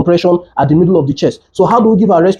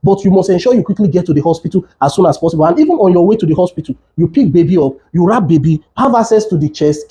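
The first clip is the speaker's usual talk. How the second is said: normal talking voice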